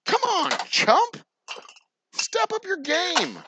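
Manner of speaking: high, slow jeering taunt; slow high-pitched mock challenge to a lazy marketer. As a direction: sneer it out high and drawn out like a schoolyard dare.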